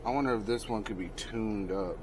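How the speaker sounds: usual speaking voice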